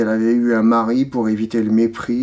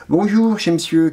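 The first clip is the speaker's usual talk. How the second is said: higher-pitched quoted greeting; 4 semitones higher than usual. Acted. Reader quoting a man's habitual hello, pitched high.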